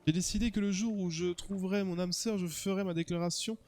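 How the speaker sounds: theatrical romantic hero telling his tale; dreamy character voice, measured delivery; lovestruck suitor. Acted. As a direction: play an earnest, smitten admirer.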